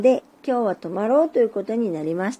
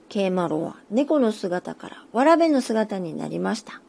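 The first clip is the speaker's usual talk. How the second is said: ordinary delivery, nothing marked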